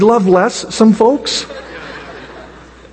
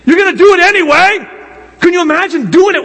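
excited burst; 8 semitones higher than usual. Real, not acted. Excited exclamation.

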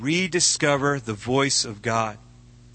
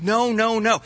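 indignant protest; 7 semitones higher than usual. Acted. Job protests to friends.

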